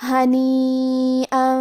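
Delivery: ہنیئا with the vowel held four beats; long madd. Shown as a demonstration. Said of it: "آواز چار مد کے برابر لمبی کریں گے".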